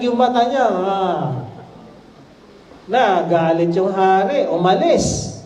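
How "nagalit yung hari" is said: ordinary talk, nothing marked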